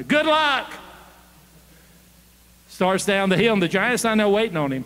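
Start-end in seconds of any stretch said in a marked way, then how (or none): 0.0-0.7 high send-off call; acted holler, pitched well up. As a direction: call it after someone walking away. King calls after departing boy.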